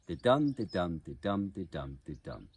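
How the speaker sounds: five even didums, each DUM stressed; iambic rhythm. Shown as a demonstration. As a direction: chant didum five times, weak-strong, falling away at the end. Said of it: "there will be five didums".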